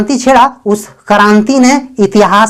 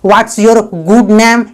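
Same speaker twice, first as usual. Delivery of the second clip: very slow, word by word; slow, clear dictation; slow model sentence; much slower than usual. Shown as a demonstration. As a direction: spell it out slowly for learners. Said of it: "इस तरह का कोई word नहीं है".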